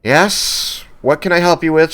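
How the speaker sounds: lightly voiced busy clerk calling out; tiefling clerk.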